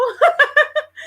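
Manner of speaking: loud high laugh; high burst of laughter. Real, not acted. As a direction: let the laugh pop out. Host laughs after quote.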